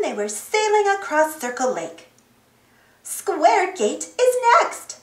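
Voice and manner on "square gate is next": high, slow, drawn-out announcement; calling. A narrator's reading. Dora announces the next landmark, calling out. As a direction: call out the next stop. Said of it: "called Dora".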